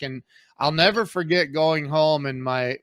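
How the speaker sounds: usual speaking voice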